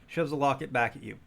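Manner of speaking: usual speaking voice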